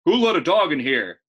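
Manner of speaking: loud angry shout of a store manager. Acted. Manager yelling across the produce aisle, loud shout. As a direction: bellow at the whole store. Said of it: "he kept on shouting".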